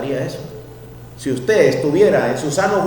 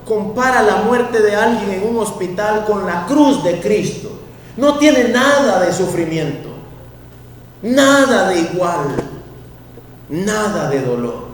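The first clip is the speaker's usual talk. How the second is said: louder, rising exhortation; impassioned preaching; 6 semitones higher, 3 dB louder than usual. Real, not acted. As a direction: press the point hard.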